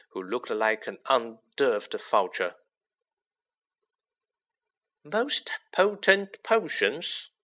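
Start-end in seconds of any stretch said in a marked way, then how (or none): 5.0-7.3 slow, drawn-out suspicious repeat; the librarian repeats the title suspiciously, slow and higher. A narrator's reading. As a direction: repeat it like you smell a trick. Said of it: "she repeated suspiciously".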